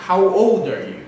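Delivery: put-on old said loud and firm; stress on old. Shown as a demonstration. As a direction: punch the word old. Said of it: "One more time".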